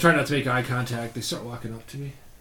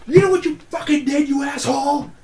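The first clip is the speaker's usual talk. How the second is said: high, louder mock outburst; imitated angry woman; 12 semitones higher, 3 dB louder than usual. Retelling the woman's parting shot after the slap, mock fury. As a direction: throw a high-pitched accusation, mimicking her.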